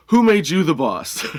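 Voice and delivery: slow, loud mock indignation; indignant challenge. Affronted customer challenges the teller.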